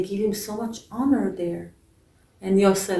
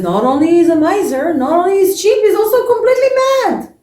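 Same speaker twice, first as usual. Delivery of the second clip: rising indignant shout, much higher and louder; indignant outburst; 9 semitones higher, 9 dB louder, faster than usual. Acted. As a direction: vent to your wife, climbing to a yell. Beggar complains to wife.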